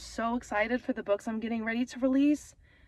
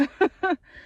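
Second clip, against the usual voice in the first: teary laugh; 8 semitones higher, 4 dB louder than usual. Real, not acted. Laughing through tears.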